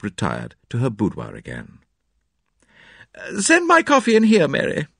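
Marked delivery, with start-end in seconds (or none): 3.2-4.9 high-pitched call to someone in another room. A narrator's reading. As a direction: call out an order, pitched well up. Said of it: "she called".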